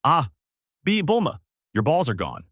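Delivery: mild narrated yell, jumping high on the name; a startled, stammered cry, only half yelled; yelled alarm. A narrator's reading. Goku alerting Bulma, mildly yelled alarm. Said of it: "He yelled out".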